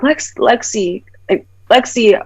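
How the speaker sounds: imitated drunk caller, drawn out; mock tipsy phone call; intoxicated mother. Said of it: "like, from her voice".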